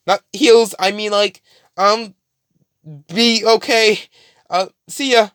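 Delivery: high, fumbling correction; flustered Deku. Retold or imitated. Deku corrects himself, leaving.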